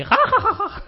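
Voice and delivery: high cackling villain laugh; evil laugh. Retold or imitated. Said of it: "con una risa malvada ahí diciendo".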